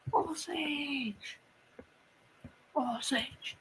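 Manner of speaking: awed whisper of the name. Real, not acted. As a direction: breathe the name in awe. Name repeated in awe.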